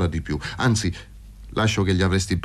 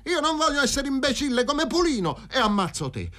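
high frenzied threat. Acted. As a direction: voice shooting up high in a wild threat. Threatening to shoot Paroni. (minaccia folle e acuta)

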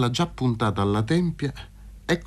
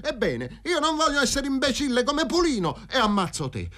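high-pitched, bitter outburst; heated tirade; 8 semitones higher than usual. A narrator's reading. Fazio threatening to kill Paroni, heated tirade.

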